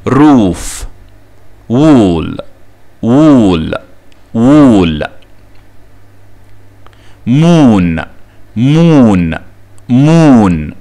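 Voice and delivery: روف, وول, مون with a long oo; long double O. Shown as a demonstration. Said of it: "وفي كلمات بتنطق لونج زي". (روف و وول و مون بمد الواو)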